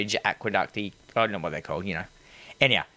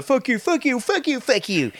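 hammed-up, much higher Australian girls trading insults; mock catfight; 12 semitones higher than usual. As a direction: parody the petty friends' fight. Mocking the girls' argument.

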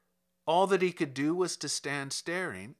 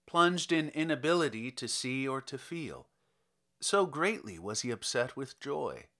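ordinary delivery, nothing marked

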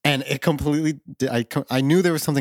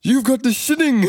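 high, loud cartoon Scottish; Willie; 13 semitones higher, 6 dB louder than usual. Groundskeeper revealing Bart's gift, hammy Scottish. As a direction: proclaim it in broad Scottish. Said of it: "it's Willie, and he talks to Bart".

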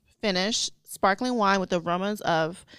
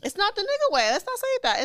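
high-pitched protest; 12 semitones higher than usual. Real, not acted. Cohost objects to her phrase.

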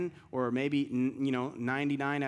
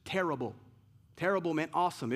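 ordinary talk, nothing marked

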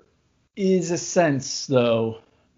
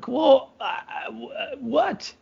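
flustered, higher stammering what; confused stammer; 8 semitones higher, slower than usual. Retold or imitated. Dad acting baffled when his wife sends him in, stammering. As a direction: caught off guard, stumbling for words.

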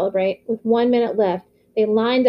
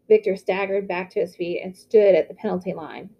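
ordinary delivery

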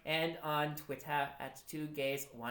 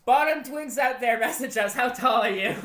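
loud call-out; 7 semitones higher, 11 dB louder than usual. Pretend. Calling out to listeners.

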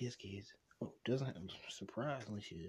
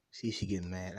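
usual speaking voice

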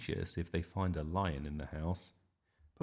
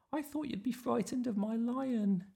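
put-on very high, steady child's voice; little Iris. Iris answering Grandma about her lion, high childlike voice. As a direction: pipe it back as a small girl. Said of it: "said Iris".

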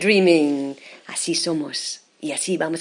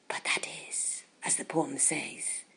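whispering; 5 semitones lower, 15 dB quieter than usual. Acted. Poem line translated, hushed.

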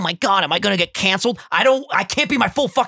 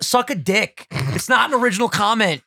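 usual speaking voice

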